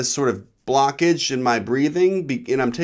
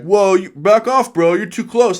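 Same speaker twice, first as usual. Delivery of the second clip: joking mock warning; 6 semitones higher, 4 dB louder, slower than usual. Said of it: "They, like, joking".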